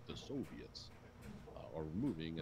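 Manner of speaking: put-on low, soft British accent. Mimicking the narrator's own voice, British accent. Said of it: "when he's talking in his British accent".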